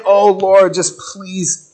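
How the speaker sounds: a hammed-up desperate prayer; urgent prayer. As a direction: beg the Lord to get Peter out. Praying church members acted out.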